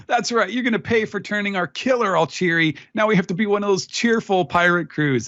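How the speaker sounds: high-pitched comic pirate voice; jeering crew. Acted. Host as the crew copying Killer, high comic grumbling. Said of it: "the crew continues".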